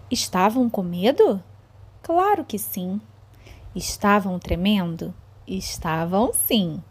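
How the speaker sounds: slow, suspenseful storytelling; reading to children. A narrator's reading. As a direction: draw the kids into a spooky tale. Teacher reads story to children.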